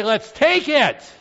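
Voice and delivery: loud, high, slow battle cry; rally the crowd to charge; rallying shout. Retold or imitated. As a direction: crowd expecting a conquering Messiah's cry. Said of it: "raise his hand and say".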